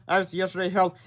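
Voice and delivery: usual speaking voice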